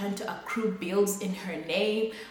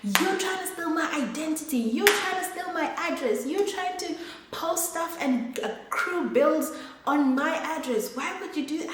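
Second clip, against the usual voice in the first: high, mock-furious accusing voice; angry neighbour's mother; 8 semitones higher than usual. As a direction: imitate her shrill accusations, pitched well up. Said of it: "She's fuming, she's angry".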